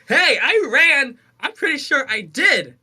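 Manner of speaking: retold exasperated self-defence; mock indignant protest. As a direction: protest your innocence, hammed up. Retelling protest to gaslighting brother.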